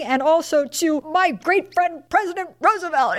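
high, forced praise through mock loathing; hating to say it. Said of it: "she just hates to say it".

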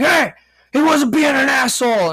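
high, loud call-out in a put-on old man's voice; mock shout from afar. An old bystander yells at the departing customer, mock shout. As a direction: yell it across the store to defend him. Old man calls out at him.

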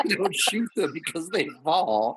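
high, giggling through the words; laughing. Real, not acted. Friend laughing at a morbid horse joke guess. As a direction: laugh while you say it.

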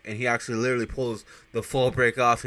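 ordinary talk

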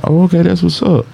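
high, breezy acceptance; high easy-going reply; mock easygoing. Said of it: "I'm like".

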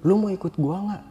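high, challenging question; retold quarrel. Brother asks who follows him down, challenging. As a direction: put it as an ultimatum.

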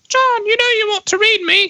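high-pitched put-on British accent. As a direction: books calling out in a high, silly British voice. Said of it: "why they have a British accent".

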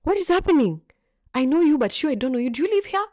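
high, loud demanding questions; stern caretaker. Retold or imitated. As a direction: challenge the strangers at the gate. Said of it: "And he's like".

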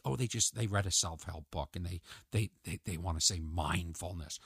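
low, soft, scornful aside; dismissive muttered thought. Retold or imitated. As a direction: mutter it with disdain. Mocking thought about self-help readers.

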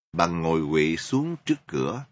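ordinary delivery, nothing marked

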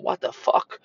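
breathy whispered aside of disbelief. Retold or imitated. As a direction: whisper it to yourself, stunned. Retold inner reaction at table.

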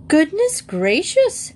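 high, drawn-out cry of astonishment; shocked exclamation, voiced for a story character; startled exclamation. A narrator's reading. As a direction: exclaim it slowly, pitched right up. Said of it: "exclaimed Missus Bird".

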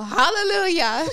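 high, loud laughing cry of relief. Real, not acted. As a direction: burst out laughing on it. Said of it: "That's how I feel".

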